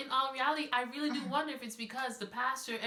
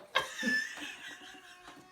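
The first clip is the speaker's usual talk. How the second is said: airy laugh. Real, not acted. Laughs after plugging the planner.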